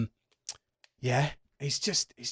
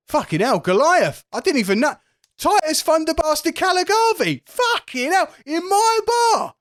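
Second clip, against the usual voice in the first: very high, loud fan shout; starstruck barman; 10 dB louder than usual. Acted. Barman spotting Titus in his bar, starstruck high shouting. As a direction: blurt it out at top pitch, building to a stretched finish. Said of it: "he looks at you, Titus, and goes".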